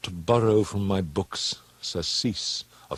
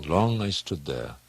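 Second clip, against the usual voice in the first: plain reading voice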